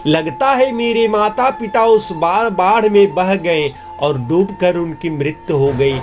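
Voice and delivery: ordinary delivery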